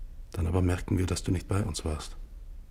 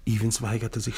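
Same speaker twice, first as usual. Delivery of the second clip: ordinary talk, nothing marked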